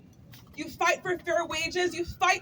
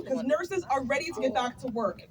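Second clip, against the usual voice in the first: ordinary talk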